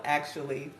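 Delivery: usual speaking voice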